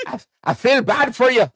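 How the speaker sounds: high, loud put-on Scottish; Scottish accent. Comedian pitying Harry Potter, put-on Scottish accent. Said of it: "was he Scottish or".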